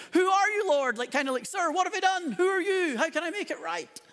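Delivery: a startled man begging to know; alarmed question. Acted. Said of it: "he asks the fairly obvious question".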